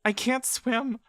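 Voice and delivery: put-on high, pleading small girl's voice; a child elf begging not to be let go; frightened little girl. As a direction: beg like a scared child clinging on. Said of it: "The voice is still Bella's".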